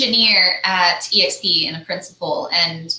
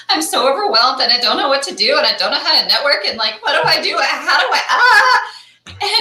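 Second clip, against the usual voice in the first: fast, higher, giggling panic; flustered, laughing; 7 semitones higher, 3 dB louder than usual. Retold or imitated. Nervous first-timer at a mixer, laughing, flustered and fast. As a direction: rush it out, laughing at your own panic. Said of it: "I was like".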